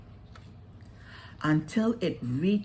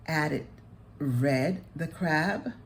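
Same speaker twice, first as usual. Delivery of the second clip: ordinary delivery, nothing marked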